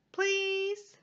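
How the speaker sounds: high begging please; pleading pigeon. Acted. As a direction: beg like a pigeon who wants the bus.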